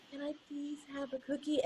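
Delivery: very soft, small, squeezed and polite; tiny squeezed voice. Retold or imitated. Child politely asking parent again, tiny squeezed voice. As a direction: squeeze it down to a timid little ask. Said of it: "with a very tiny squeezed voice".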